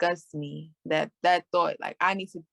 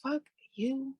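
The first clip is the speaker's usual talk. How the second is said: high, soft "forget you" retort; lightly acted, softly pitched-up brush-off; mock dismissal; 7 semitones higher, 5 dB quieter than usual. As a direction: toss off a quiet, high-pitched kiss-off. Imagined retort to an unhappy partner.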